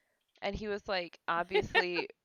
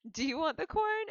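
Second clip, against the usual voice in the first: higher, casual offer of corn; Darren offering the corn; 7 semitones higher, 3 dB louder than usual. Retold or imitated. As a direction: ask it lightly, as an offer. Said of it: "Darren's like".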